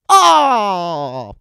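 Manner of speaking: long "oh" sliding down in pitch; drawn-out, falling exasperated cry; mock angry groan. Host fed up with non-subscribers, mock groan.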